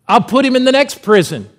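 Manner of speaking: loud, high-pitched acted threat; threatening captain. Captain threatening whoever fed the prisoner, loud and indignant. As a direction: bellow a jailer's threat.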